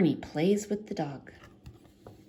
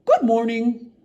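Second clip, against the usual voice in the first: cheery Barney greeting; 3 semitones higher, 9 dB louder than usual. A narrator's reading. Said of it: "Barney says".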